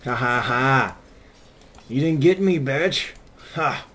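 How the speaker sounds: loud, high gloating laugh and jeer; taunting laugh. Real, not acted. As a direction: crow over the enemy who missed you. Taunting enemy after escaping.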